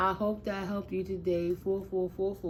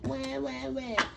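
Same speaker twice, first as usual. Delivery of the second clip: mock crying baby; 5 semitones higher than usual. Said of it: "kicking and screaming like big old babies".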